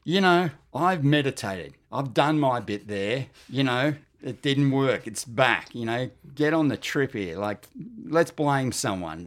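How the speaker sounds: loud, heated retelling of his outburst; angry retort. Retold or imitated. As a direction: push back hard, fed up and furious. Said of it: "And I was really angry".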